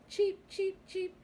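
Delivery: high, tiny, thrilled cheeps; excited chirping. Acted. Little chicks exclaim with joy riding on Horse's back, excited high cheeps. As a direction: squeal it out like excited baby chicks. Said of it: "exclaimed the little chicks".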